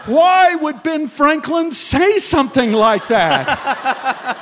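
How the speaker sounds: slow, high, loud laughing plea; exasperated shout. Retold or imitated. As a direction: drag it out in comic disbelief.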